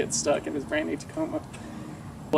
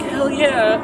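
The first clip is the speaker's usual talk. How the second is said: pumped, loud cheer; excited; 10 semitones higher, 4 dB louder than usual. Retold or imitated. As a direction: celebrate the good news. Excited quote about Tacoma coming.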